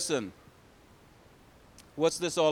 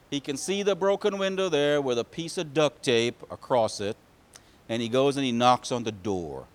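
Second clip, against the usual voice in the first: usual speaking voice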